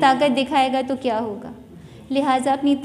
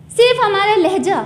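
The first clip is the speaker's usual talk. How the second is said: usual speaking voice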